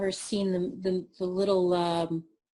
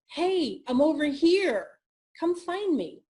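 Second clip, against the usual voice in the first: high, inviting call; beacon calling out; 7 semitones higher, 3 dB louder than usual. Acted. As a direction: call out to be found. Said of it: "beacon of light that says".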